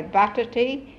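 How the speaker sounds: "butter tea" for potatoes, opening on B; local pronunciation. Shown as a demonstration. Quoting the servants' word for potatoes, local pronunciation. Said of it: "They can't pronounce the P".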